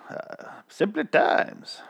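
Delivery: put-on slow, breathy whispered old reel voice; old timey voice. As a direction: whisper it like a vintage narrator. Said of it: "almost slipped into the opening narration voice".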